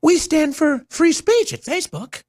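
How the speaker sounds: high, squeaky mock voice; mocking Zuckerberg voice. Host mocking Zuckerberg's free speech claim in a squeaky high voice. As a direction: mimic him in a silly high voice. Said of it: "Really, Mark?".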